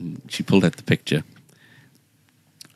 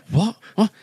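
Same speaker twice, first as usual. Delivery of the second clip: high, stunned double what with a laugh; a startled laughing what, what; shocked disbelief. Retold or imitated. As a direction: sound completely caught off guard. Shocked by surprise gift.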